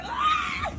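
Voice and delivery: delighted squeal. Real, not acted. Mother greeting her small child.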